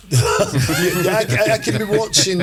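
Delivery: sheepish laughing admission. Real, not acted. Admits watching chick flicks, laughing.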